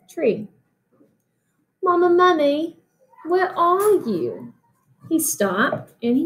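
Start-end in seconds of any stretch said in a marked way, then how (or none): 1.8-4.5 higher worried call. Acted. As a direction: call out for mama in the dark. Baby mummy calling.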